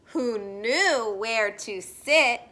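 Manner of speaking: slow, sing-song picture-book read-aloud; gentle storybook voice for little listeners; reading to children. Acted. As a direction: read it like story time on the rug.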